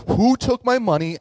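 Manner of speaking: high, loud indignant cry; upset outcry. Retold or imitated. Woman finding her savings gone, high and indignant. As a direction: demand to know who did it. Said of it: "she's like".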